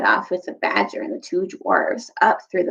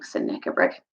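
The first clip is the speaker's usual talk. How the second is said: ordinary delivery, nothing marked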